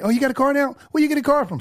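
high, surprised friend voice; a friend amazed on the phone. Retold or imitated. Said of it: "call your friends".

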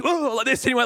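ordinary talk

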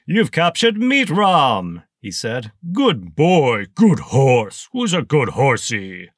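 high, loud villain voice, doting on the horse; gloating colonel. Acted. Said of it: "like a rusty saw blade slicing through your spine".

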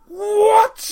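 high, loud disbelieving yelp; a stunned cry at the screen; shocked what. Real, not acted. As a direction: blurt it out in total shock. Series ends on a cliffhanger.

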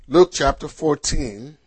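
usual speaking voice

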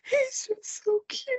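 high, drawn-out weepy squeak; imitated crying. Mom crying over cute son, imitated slow wail. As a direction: wail softly as if overcome. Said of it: "and I'm crying".

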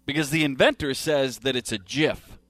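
usual speaking voice

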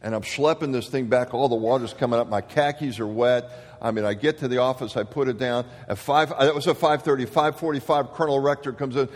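usual speaking voice

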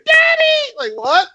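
put-on very high shouted child voice; child shouting. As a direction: yell like a kid running to dad. Child calling back to father.

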